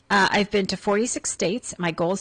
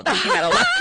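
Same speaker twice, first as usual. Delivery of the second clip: laughing; 5 dB louder than usual. Real, not acted. Surprised by profile wording.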